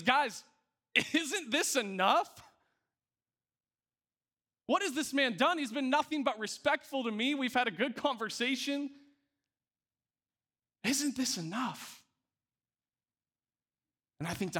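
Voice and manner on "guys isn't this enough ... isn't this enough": pleading Pilate. Retold or imitated. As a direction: beg the crowd to stop, pitched up.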